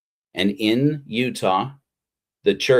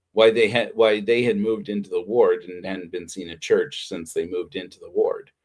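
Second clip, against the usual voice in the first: ordinary delivery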